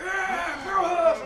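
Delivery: loud, high cheering shout; excited fan shout. Retold or imitated. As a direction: cheer like a fan spotting a star. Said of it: "five or six people like".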